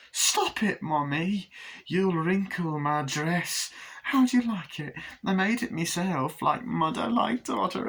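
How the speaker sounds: high, prissy put-on character voice; taunting child killer. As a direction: tease Mommy in a high sing-out. Glenda taunts Mommy, acted.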